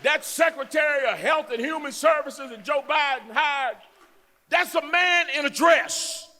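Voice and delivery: high, loud pulpit shout; fiery preaching. Acted. Lieutenant governor rails against other politicians, shouting. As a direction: rail at them from the stage.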